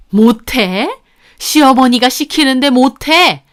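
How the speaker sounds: put-on loud, indignant older in-law voice; angry mother-in-law. A narrator's reading. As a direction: demand it in affronted disbelief. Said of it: "어머님 갑자기 분노하셨고". (분노한 시어머니 목소리)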